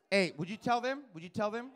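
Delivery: ordinary talk, nothing marked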